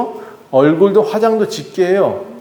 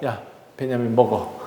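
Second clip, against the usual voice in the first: tender coaxing; 6 semitones lower, 9 dB quieter than usual. Acted. Father feeding his youngest son.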